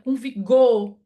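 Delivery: lively teacher waking up the class; energetic. Shown as a demonstration. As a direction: say it with punch and energy. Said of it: "falando com energia".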